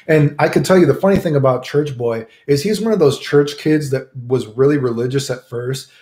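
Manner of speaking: ordinary talk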